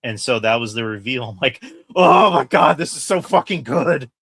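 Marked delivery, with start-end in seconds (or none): 1.9-4.1 loud, high fan excitement; re-enacted thrilled outburst. Fan reliving the big reveal, loud excited outburst. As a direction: burst out, bowled over by the reveal.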